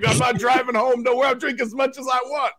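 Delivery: imitated drunk yelling. Host imitating drunk fan brushing off worries, mock yelling. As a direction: play a drunk guy insisting. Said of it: "he just kept yelling".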